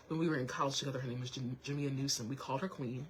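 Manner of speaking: normal talking voice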